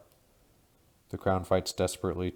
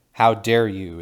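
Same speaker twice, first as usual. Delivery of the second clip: louder enraged outburst; furious scream; 3 semitones higher, 11 dB louder, slower than usual. A narrator's reading. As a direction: scream in outrage. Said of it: "it screams".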